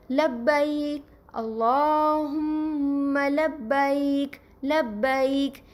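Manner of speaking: لبیک drawn out slowly with a clear ai glide; Arabic pronunciation. Shown as a demonstration. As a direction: stretch لبیک and اللہم slowly, Arabic style. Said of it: "عربی میں کس طرح پڑھا جائے گا".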